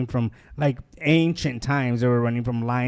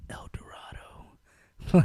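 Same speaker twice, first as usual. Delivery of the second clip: awed whisper; 6 dB quieter, much slower than usual. Retold or imitated. Awed reveal of weed field.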